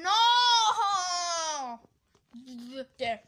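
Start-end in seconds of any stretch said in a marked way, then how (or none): 0.0-1.8 high, loud, drawn-out no; wailing scream. Pretend. Protesting as ice cream gets made, wailing scream. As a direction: wail it long, sliding down in pitch.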